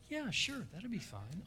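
soft, casual acceptance, holding it in; outwardly composed. Retold or imitated. Painter offered a check up front, soft and casual. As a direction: play it cool and quiet. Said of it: "On the outside".

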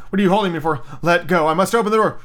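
high, urgent demand; frantic protest. A narrator's reading. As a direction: fight to be let go. Mother struggles to open door.

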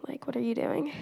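hushed, disbelieving whisper; whispered scolding. Retold or imitated. Parents to daughter refusing to call Lisa grandmother, hushed disbelief. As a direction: breathe it like a shocked parent.

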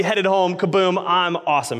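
ordinary talk

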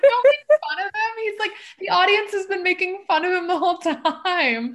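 high, loud mock protest; defending a character she just mocked, high and loud. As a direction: squeal it out defending him, half laughing. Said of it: "So I'm like".

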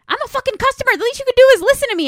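high, loud, fast furious rant; snapping. Retold or imitated. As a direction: explode at him, shrill and rushed. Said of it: "she just fucking snaps".

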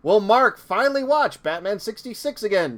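dramatic announcer outro for the podcast episode; announcer teaser. Pretend. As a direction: tease the next episode like an old serial narrator. Cliffhanger outro in announcer style.

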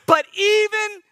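even if drawn out high. Acted. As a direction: stretch out even, high and slow, then hold. Dramatic pause in prayer.

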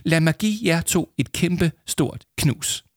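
high, slow, drawn-out affection; gushing teacher. A narrator's reading. Teacher about to hug two pupils hard, gushing. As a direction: gush and stretch the words out.

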